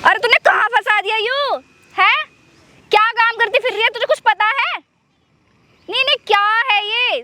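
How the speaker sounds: annoyed telling-off; exasperated scolding. Acted. Scolding someone over the phone.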